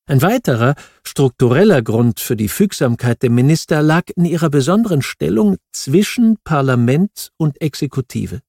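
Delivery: ordinary talk, nothing marked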